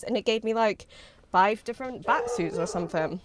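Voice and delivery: ordinary talk, nothing marked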